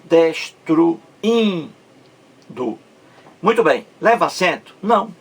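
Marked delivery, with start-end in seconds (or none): 0.0-1.7 "Destruindo" slowly split as des-tru, in, do; slow teacher's breakdown of "Destruindo", pausing round the in; syllable breakdown. Shown as a demonstration. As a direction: break "Destruindo" apart, isolating a long in. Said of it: "A mais forte é esse aí".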